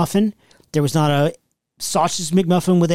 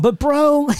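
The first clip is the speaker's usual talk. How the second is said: mock plea; 10 semitones higher than usual. Echoes the plea higher.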